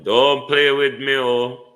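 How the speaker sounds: loud, exasperated telling-off; loud scolding. Real, not acted. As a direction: scold the phone loudly. Scolding the misbehaving app.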